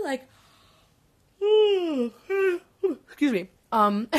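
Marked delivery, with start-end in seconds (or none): 1.4-2.6 a big, high, open-mouthed yawn; loud yawn. Real, not acted. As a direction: let out a loud sleepy yawn. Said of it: "people are gonna hear that yawn".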